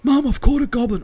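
high, loud imitation of an excited caller; giddy call to Mum; excited son. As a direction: announce it high and thrilled. Joe's excited call acted out.

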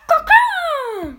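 high loud cawing cry; crow caw. Retold or imitated. Imitating the Crow voice line.

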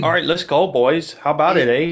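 mock Canadian hype voice; fake Canadian accent. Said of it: "Doing the fake Canadian accent".

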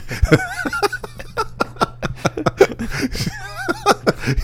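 long, high cackling laughter. Real, not acted. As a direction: let the laugh run on.

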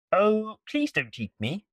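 high, small pleading voice; pleading spider. Acted. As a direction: beg in a tiny high voice.

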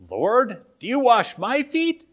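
high, loud incredulous question rising at the end; shocked question. Retold or imitated. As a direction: ask it in open shock, voice jumping up. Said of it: "a question of shock on Peter's part".

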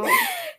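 sudden high laugh; high burst of laughter. Real, not acted. Laughing at grim memory.

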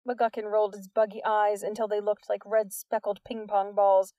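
usual speaking voice

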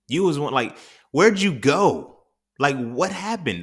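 acted-out hurt bewilderment, stretching the words; hurt disbelief. Speaker to people who changed in the pandemic, hurt disbelief. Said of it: "it hurt my feelings".

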